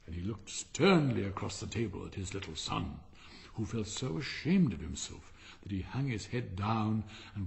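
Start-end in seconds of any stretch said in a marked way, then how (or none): none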